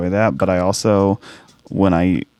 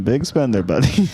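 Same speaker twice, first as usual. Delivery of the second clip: put-on Iranian accent; 5 semitones higher than usual. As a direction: ham up a Persian accent. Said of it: "I practice my Iranian".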